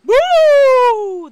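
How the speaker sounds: loud, high, drawn-out ghost boo; shriek. A narrator's reading. Principal demonstrating real haunting to students, piercing shriek. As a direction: shriek it to scare everyone. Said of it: "the principal shrieked".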